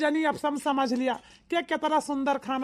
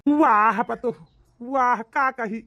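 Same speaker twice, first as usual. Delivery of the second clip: loud praise; 2 semitones lower, 7 dB louder, slower than usual. Acted. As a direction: boom out your approval of the meal. Father-in-law praises the food.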